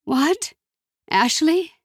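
high, startled questioning; puzzled on a bad line; confused mother.

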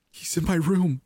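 high, panicked realisation; terrified. A narrator's reading. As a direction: let the voice leap up in terror. Intruder has entered her bedroom.

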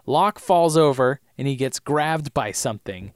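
usual speaking voice

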